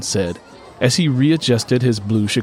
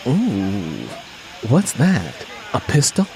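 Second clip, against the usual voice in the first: naughty tease; slower than usual. A narrator's reading. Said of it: "behave naughtily".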